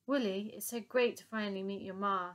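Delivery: ordinary delivery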